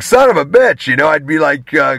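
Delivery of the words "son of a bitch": high-pitched exasperated quote; lightly acted exasperation; mock frustration. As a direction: curse in playful frustration, pitched up. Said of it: "it'd be frustrating for me".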